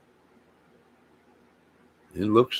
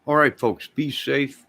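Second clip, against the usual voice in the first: cheerful sign-off; 6 semitones higher, 3 dB louder than usual. Real, not acted. Signing off to viewers.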